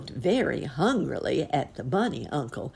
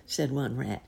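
ordinary delivery

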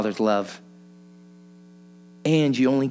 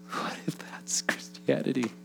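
whisper; 2 semitones higher, 9 dB quieter than usual. Real, not acted. Closing rhetorical question.

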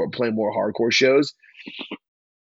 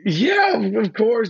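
very high flustered yes; starstruck yes; 10 semitones higher, 4 dB louder, slower than usual. Retold or imitated. Young musician agreeing to a photo, high starstruck yes. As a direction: squeak it out starstruck. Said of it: "So I was like".

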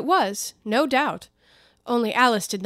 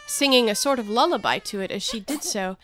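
ordinary delivery, nothing marked

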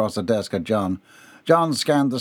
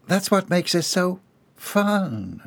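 high, slow, coy drawl; flirtatious; 7 semitones higher, slower than usual. A narrator's reading. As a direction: linger playfully on the last word. Flirting woman after giggle.

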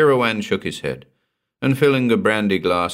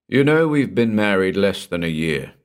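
ordinary delivery, nothing marked

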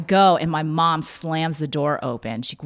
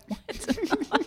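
stunned what; 8 dB quieter than usual. Retold or imitated. Stunned by mom's odd answer.

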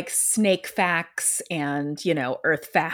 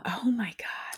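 hushed shocked whisper; 11 dB quieter, slower than usual. Real, not acted. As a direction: breathe it out in shock. Shocked reaction to murder story.

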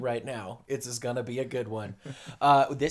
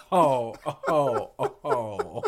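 mock laughing groan; 4 dB louder, much slower than usual. Mock old-man laughing exclamation.

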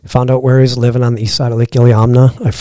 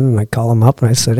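normal talking voice

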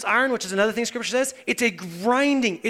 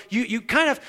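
ordinary delivery

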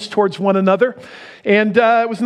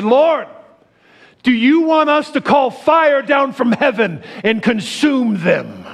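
eager disciples; 8 semitones higher, slower than usual. Retold or imitated. James and John begging Jesus for fire, hammed-up eagerness. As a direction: ham up the zeal for fire. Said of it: "they said".

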